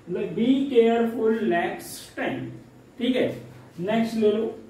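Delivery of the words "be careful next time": "Be careful next time" stretched very slowly; slow teaching demo. Shown as a demonstration. Teacher slowly repeats the English phrase for students. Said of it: "अंग्रेजी में बता देनी होती है".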